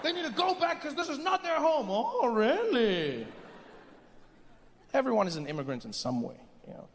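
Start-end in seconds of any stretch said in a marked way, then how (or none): 0.0-1.9 high-pitched mock indignation; imitating a worked-up anti-immigrant complainer; confident complainer. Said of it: "People confidently saying". 1.9-3.3 very slow, stretched mock surprise; drawn-out feigned fascination; sarcastic interest. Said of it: "And they're like".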